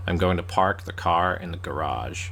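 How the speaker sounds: low-pitched mock American voice; American accent. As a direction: imitate an American saying "guh-RAHZH". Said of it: "that's a really good American accent".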